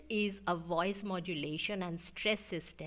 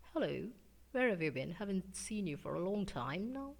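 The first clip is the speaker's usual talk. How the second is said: English accent with strong consonants; English accent, unmusical; faster than usual. Shown as a demonstration. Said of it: "Strong consonants and no music".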